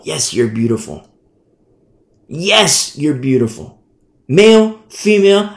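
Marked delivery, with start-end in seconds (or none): none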